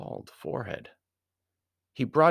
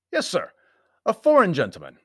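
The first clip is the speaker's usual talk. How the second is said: high, louder clerk's voice; desk clerk; 10 semitones higher, 8 dB louder than usual. A narrator's reading. Clerk announcing a visitor by telephone, high role voice. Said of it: "The voice of the desk clerk".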